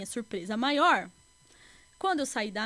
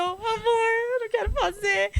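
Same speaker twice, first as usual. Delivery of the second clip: real tears breaking through the words; higher and louder, voice cracking with real crying; telling the host about that day while crying; 9 semitones higher, 6 dB louder than usual. Real, not acted. Retelling a painful moment, voice breaks.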